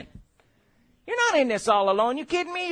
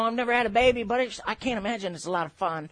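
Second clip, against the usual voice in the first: ordinary talk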